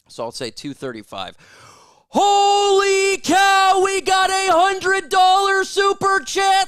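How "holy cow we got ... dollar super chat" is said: much higher, louder, slow shout of disbelief; stunned hype. Real, not acted. Streamer reacting to a hundred-dollar super chat, shouting in disbelief. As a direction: shout it out like you can't believe it.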